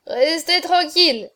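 high, loud tipsy protest; drunken babble. A narrator's reading. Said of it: "balbutiait-il".

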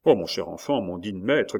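plain reading voice

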